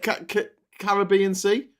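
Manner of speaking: ranting happily about history; excited. Real, not acted. Retelling the Seven Years' War to a friend, excited.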